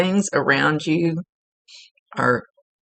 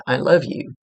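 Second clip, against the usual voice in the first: usual speaking voice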